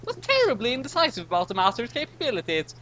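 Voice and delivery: high, fussy narrator-character voice; high character voice musing aloud; high-voiced character. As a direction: pitch it way up and fuss over the logic.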